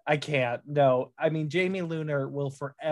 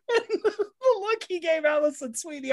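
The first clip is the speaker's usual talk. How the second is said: laughing high; 13 semitones higher than usual. Real, not acted. Laughing while recalling.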